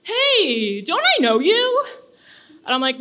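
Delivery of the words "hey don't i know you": high, rising double take; surprised recognition. Retold or imitated. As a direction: catch her out, amused. Guy recognizes her from Tinder.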